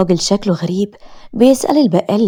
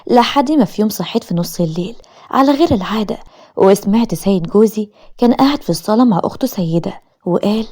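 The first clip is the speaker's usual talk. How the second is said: ordinary delivery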